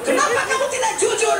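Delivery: high, loud accusing shout; mock stern rebuke. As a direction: thunder at him as the offended angel, hammed up. Angel rebukes the dishonest man. (bentakan malaikat yang menegur, dibuat-buat)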